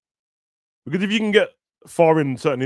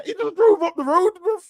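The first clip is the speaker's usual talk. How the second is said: squealing outrage; 5 dB louder than usual. Real, not acted. Mock outrage at movie plot.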